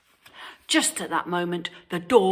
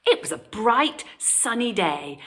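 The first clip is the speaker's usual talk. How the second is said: usual speaking voice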